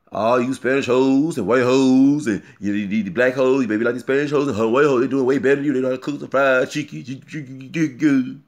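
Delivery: loud put-on rant imitating a rapper. Vlogger mimicking the rapper's rant about women, mocking and loud. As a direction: caricature his attitude, loud and run-on. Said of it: "talking about his attitude".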